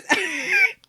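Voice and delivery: sudden high burst of laughter; a bright squealing laugh. Real, not acted. Laughing at shared quirk.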